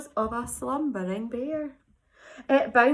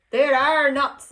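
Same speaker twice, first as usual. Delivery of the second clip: louder exclaiming; 8 dB louder than usual. Acted. Squirrel Bruce protests as the bird takes the cone. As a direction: protest it loudly. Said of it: "exclaimed Bruce".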